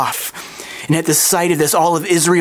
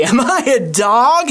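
mild mock roar, higher and drawn out; a slow, raised, indignant challenge; 6 semitones higher, slower than usual. A narrator's reading. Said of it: "he roared at David".

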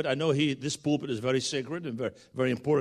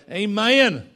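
loud Southern "Amen", stretched at the end; imitated Southern accent; 6 semitones higher, 9 dB louder than usual. Preacher showing how little Judah says it, in a loud Southern accent. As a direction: belt "Amen" with a Southern drawl. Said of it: "that's as son as you're gonna get".

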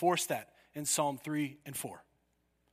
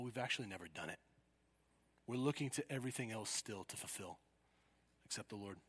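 quiet searching; 5 semitones lower, 7 dB quieter than usual. Real, not acted. Searching question, dropping voice.

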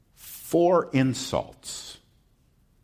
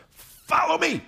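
much higher, eager call to the town; excited call. Acted. Samaritan woman calls the townspeople, high eager call. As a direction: call them to come along.

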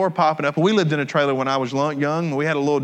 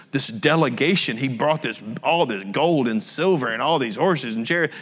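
ordinary talk, nothing marked

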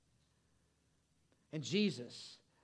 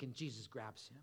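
ordinary delivery

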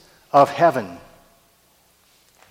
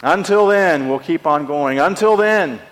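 full force preaching; 5 semitones higher, 5 dB louder than usual. Acted.

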